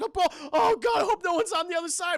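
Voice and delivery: high-pitched, frantic driver; panicked. Acted. Charlie changing lanes blind, high and panicked. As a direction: fret loudly as you swerve.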